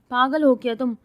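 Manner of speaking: loud scolding jab. A narrator's reading. Girl scolds the sleepy man, loud scolding. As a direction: snap it at him loudly. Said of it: "ڈانٹتی بولی".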